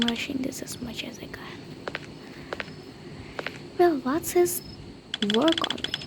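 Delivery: fast hushed whisper; quick whispered aside. Real, not acted. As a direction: rush it out under your breath. Hurried whisper while working.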